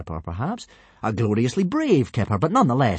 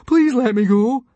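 pleading; 9 dB louder than usual. A narrator's reading. Fishlegs pleads with soldiers.

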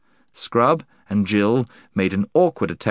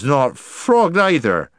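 slow, louder slurred protest; tipsy insistence; 4 semitones higher, 3 dB louder, slower than usual. Acted. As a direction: insist it, a bit drunk.

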